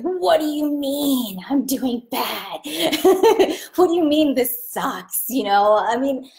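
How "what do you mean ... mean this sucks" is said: higher, laughing mock outrage at criticism; a half-laughing, hammed-up huff; mock indignant outburst. To a critic, [name] plays herself huffing in mock fury. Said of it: "furious and angry, I'm like".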